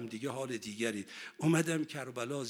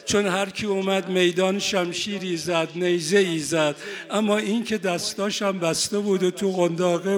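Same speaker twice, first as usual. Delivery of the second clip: preaching louder and louder toward the climax; building preacher's lament; 4 semitones higher, 8 dB louder than usual. Acted. Preacher telling the crowd of the infant, building loud. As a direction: push the lament up with full force. Mourning sermon rising in force.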